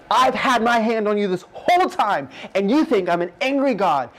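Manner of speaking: piercing voice of God. Retold or imitated. God telling Jojo he was always watched over, piercing and emphatic. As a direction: proclaim it so it goes right through the listener. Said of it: "speaking with this voice that just goes through me".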